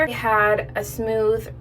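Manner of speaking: ordinary delivery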